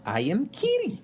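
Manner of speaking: high, a light hint of a small bird-girl mimic; Kiri parroting. Kiri copies Jester's coached words as a small mimic. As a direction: parrot the line back like a tiny mimic.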